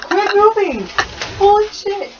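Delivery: high, panicked yelps; startled shriek. Real, not acted. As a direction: freak out at a spider. Spider on her shoulder.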